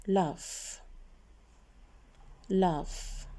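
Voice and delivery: "love" said as "laff", ending on f; slow model of "love" with a clear final f; F-sound ending. Shown as a demonstration. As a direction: say "love" like "laff" for pupils to copy. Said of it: "we pronounce it like F sound".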